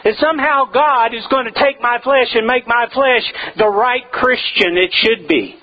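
normal talking voice

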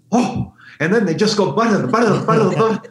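high, loud gushing repeat of the butter; gushing fan. Retold or imitated. A fan overcome with thanks in the airport, loud and high. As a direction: gush like an overwhelmed fan. Mimicking grateful fans.

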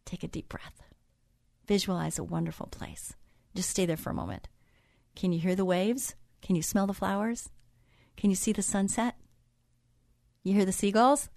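soft, quiet calming voice, breathy start; hushed, soothing meditation guide; guided relaxation. Acted. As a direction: ease them into calm, gently. Leads a relaxation moment.

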